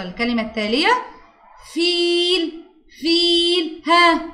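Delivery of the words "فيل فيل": "فيل" with a high, sustained "ee"; long ee vowel. Shown as a demonstration. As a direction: hold the middle of "فيل". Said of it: "مد بالياء". (مدّ الياء في "فيل")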